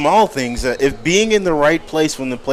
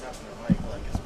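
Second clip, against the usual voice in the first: whispered aside; 12 dB quieter, much faster than usual. Real, not acted. Off-mic aside about the mic tapping.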